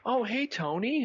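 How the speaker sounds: high cheery greeting; bubbly high-pitched hello; bartender voice. Retold or imitated. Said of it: "the bartender's like".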